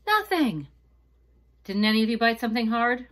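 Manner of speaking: high, loud, fast outburst; shocked dismay. Acted. Maria finding nothing in the last tamale, dismayed outburst. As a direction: burst out in disbelief.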